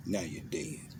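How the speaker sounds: hushed, voiceless whisper; breathed, chilling whisper. Acted. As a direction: drop to a whisper for the final blow. Dramatic whispered punchline.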